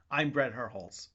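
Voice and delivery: ordinary talk, nothing marked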